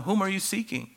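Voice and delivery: first asking, high and searching. Retold or imitated. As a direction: raise the pitch on the question, probing. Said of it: "the inflection of his voice".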